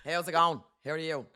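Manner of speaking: light Dublin "How's it going"; casual Irish greeting; a hint of a Dublin lad; Dublin geezer voice. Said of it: "That's what he sounds like".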